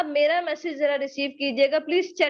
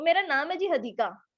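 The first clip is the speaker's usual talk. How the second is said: ordinary talk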